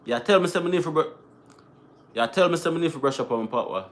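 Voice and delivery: patois, restarting to get it right; Jamaican patois. Shown as a demonstration. Vlogger rehearsing for chat in Jamaican patois. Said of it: "I'm trying to say that in patois".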